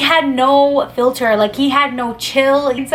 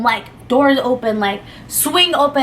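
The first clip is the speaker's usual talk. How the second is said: usual speaking voice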